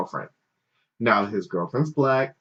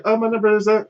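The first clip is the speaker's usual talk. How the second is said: mock woman's voice; 11 semitones higher, 3 dB louder, much faster than usual. Woman voiced high giving number.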